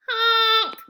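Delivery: high, loud honking horn sound; loud honk noise. Acted. Grandpa's noisemaker at the ceremony, a loud high honk.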